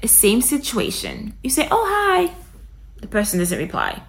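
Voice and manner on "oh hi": high, light greeting; a quick cheerful hello; friendly hi. Pretend. Saying hi to a stranger who won't reply, upbeat and high. Same elevator greeting again.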